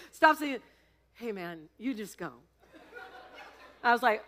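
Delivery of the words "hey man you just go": weary clown. Retold or imitated. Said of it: "the clown just goes".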